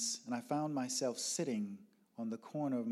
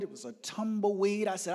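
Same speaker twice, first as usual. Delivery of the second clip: normal talking voice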